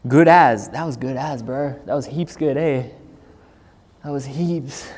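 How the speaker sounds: put-on a streamer obliging chat by saying "heaps good" in a mild Melbourne accent. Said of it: "Gotta say heaps for a Melbourne accent".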